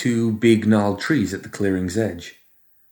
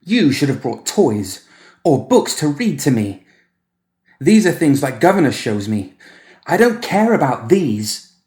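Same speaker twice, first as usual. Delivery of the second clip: put-on high, louder, slow whining boy; sulky child; 5 semitones higher, 4 dB louder than usual. Boy complaining to Edmund, high and whining. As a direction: whine like a spoilt child.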